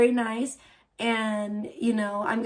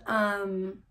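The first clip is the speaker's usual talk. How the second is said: usual speaking voice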